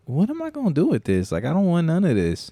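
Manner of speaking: high-pitched exasperated complaint; retold baffled protest. DJ retelling being handed drugs, baffled protest.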